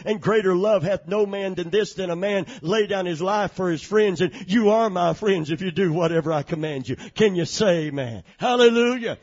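loud, high, ringing preacher's cry; full-force preaching. Acted. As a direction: preach it out to the whole church.

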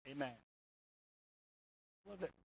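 usual speaking voice

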